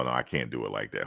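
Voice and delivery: ordinary talk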